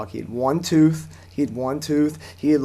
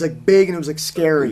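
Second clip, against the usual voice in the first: normal talking voice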